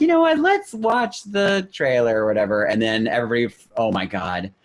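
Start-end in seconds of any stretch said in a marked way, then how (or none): 0.0-2.2 higher, slow mock suggestion; sing-song higher fake nonchalance; drawn-out pitched-up mock casual; mock crowd. Crowd voice retold.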